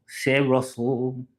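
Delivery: "C'est Russell" slow, stress on C'est; French introduction. Shown as a demonstration. Teaching viewers to say their name in French, slowly. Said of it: "that's how you say, like, in French".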